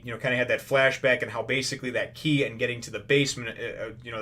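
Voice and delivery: ordinary talk, nothing marked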